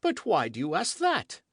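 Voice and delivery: suspicious king. A narrator's reading. King questioning the queen, suspicious. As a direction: probe her motive.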